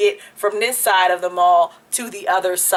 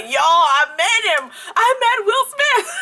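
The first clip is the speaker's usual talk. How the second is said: slow, much higher, laughing through tears; starstruck; 11 semitones higher, slower than usual. Retold or imitated. Telling friends she met [name], tearful squeal. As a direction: squeal it, half crying half laughing. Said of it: "I was like".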